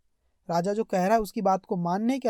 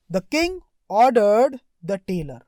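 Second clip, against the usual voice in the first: slow story reading; 6 dB louder, much slower than usual. Shown as a demonstration. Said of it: "reading the story line slowly".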